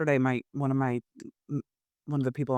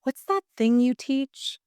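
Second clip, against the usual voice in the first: imitating mom; 9 semitones higher, 3 dB louder, slower than usual. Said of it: "my mom said to me".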